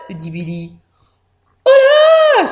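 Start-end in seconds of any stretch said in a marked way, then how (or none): 1.7-2.5 startled little yelp; startled mouse. A narrator's reading. Mouse surprised by Billy's order, startled. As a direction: jump as if caught off guard. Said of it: "sursaute la souris".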